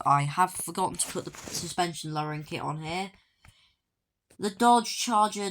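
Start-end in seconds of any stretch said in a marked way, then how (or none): none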